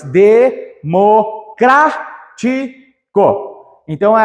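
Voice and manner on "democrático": Democrático drawn out even slower with a rising end; de-mo-crá-ti-co, very slow and rising; slow syllable by syllable. Shown as a demonstration. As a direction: stretch Democrático out syllable by syllable, ending up. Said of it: "Separa as sílabas".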